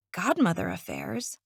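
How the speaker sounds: usual speaking voice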